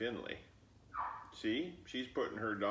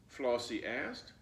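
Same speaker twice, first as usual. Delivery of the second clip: plain reading voice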